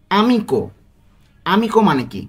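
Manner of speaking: ordinary talk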